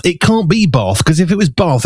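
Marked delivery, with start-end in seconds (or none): 0.7-1.0 BATH as if spelt "barth", R silent; southern English "bahth" for "bath"; long-vowel bath. Shown as a demonstration. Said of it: "there's no R in the middle". 1.5-1.9 BATH as if spelt "baath"; southern English "bahth" for "bath"; long-vowel bath. Shown as a demonstration. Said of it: "it'd be B A A T H".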